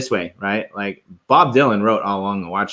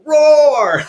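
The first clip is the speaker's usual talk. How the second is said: put-on much higher, louder kid-style roar; toddler roar; 7 dB louder than usual. Dad cheering an artist like his daughter would, high loud roar. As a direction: point and roar like his one-year-old. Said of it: "I just always wanna point and go".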